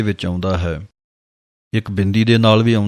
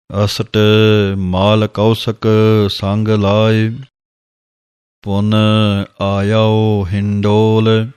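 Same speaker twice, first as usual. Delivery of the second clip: slow, drawn-out devotional reading of gurbani; slow scripture recitation; 3 dB louder, much slower than usual. Acted. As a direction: recite each word slowly and reverently. (ਹੌਲੀ ਹੌਲੀ ਗੁਰਬਾਣੀ ਦਾ ਪਾਠ)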